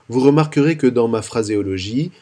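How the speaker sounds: normal talking voice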